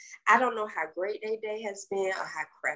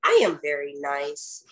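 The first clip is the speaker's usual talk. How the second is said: usual speaking voice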